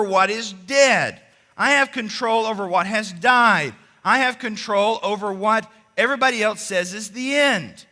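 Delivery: loud, emphatic preaching with falling ends; full-force preaching. Acted. Preacher to congregation, loud emphatic preaching. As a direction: drive each claim home from the pulpit.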